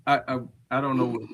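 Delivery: ordinary talk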